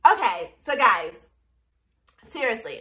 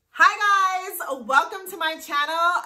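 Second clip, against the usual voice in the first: excited greeting; 7 semitones higher, 4 dB louder than usual. Real, not acted. YouTube intro greeting.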